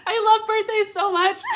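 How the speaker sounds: high, loud, laughing through the words; laughing gush. Real, not acted. Host tells chat she loves birthdays, laughing. Gushing over viewer's birthday.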